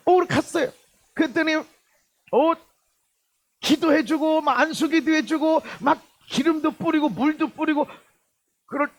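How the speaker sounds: high-pitched, eager sermon storytelling; animated preaching. Real, not acted. Preacher imagining the healing the general expected, animated preaching. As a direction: pile up each imagined step at a high pitch.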